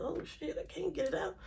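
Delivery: quiet frustrated aside; frustrated mutter. Pretend. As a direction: grumble to yourself, stuck and annoyed.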